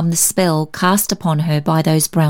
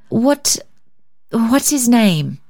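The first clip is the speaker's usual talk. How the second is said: hesitant, higher-pitched, slow question; weak inquiry; 5 semitones higher, much slower than usual. A narrator's reading. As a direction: ask weakly, faltering after the first word. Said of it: "she inquired weakly".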